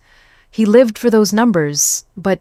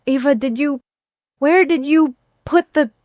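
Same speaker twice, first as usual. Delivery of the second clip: much higher, wandering confused voice; 9 semitones higher than usual. Acted. Impaired husband calling to absent Ava, confused. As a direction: speak to someone who isn't there. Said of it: "suddenly looking confused".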